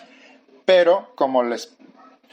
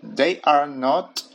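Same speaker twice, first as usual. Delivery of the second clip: slow demo; much slower than usual. Shown as a demonstration. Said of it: "Pronombre personal, they".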